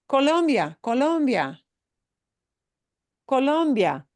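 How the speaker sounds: "Colombia" with a high, punched "lom"; co-LOM-bia, middle syllable lifted, "bia" as one; stress on LOM. Shown as a demonstration. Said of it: "It's Colombia".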